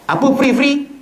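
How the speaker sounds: high, loud angry snap from the imam. Acted. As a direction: bark it like an irritated imam. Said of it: "Oh, marah".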